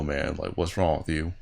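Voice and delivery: ordinary talk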